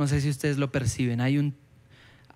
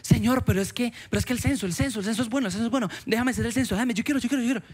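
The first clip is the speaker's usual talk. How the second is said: put-on higher, insistent begging like a stubborn child; pleading with God; 6 semitones higher, much faster than usual. A believer begging God to allow the census, childish whining. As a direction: nag and whine for permission. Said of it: "así actuamos a veces con Dios".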